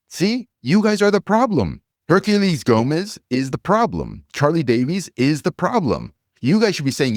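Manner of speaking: rising on-air rant; heated rant. Real, not acted. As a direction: jab the blame at each name in turn. Ranting at the fans.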